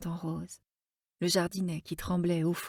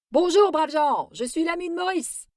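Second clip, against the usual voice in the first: loud, much higher greeting called from the doorway; shouting loudly; 10 semitones higher, 8 dB louder than usual. Acted. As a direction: call out to startle the sleeping room. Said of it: "en criant bien fort". (crié très fort, beaucoup plus haut)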